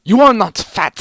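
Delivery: high, loud fluttery Medic. A narrator's reading. Medic reassuring Heavy, high loud fluttery voice. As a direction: insist high and breathless.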